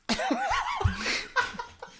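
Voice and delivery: high-pitched long laugh; real helpless laughter; burst of laughter. Real, not acted. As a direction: lose it laughing at the joke. Laughing after the joke note.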